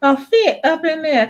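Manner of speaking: aunt recalling. Retold or imitated. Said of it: "And she said".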